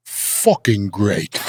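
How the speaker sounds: imitation Cockney British accent, low and drawn out. Keith looks at his old bodyguard, now governor, Cockney accent. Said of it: "in that Cockney British accent".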